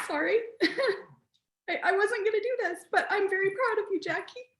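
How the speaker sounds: high, wobbly laugh through real tears; apologize, laughing as she tears up; tearful apology. Real, not acted. Host tearing up, telling Jackie she's proud.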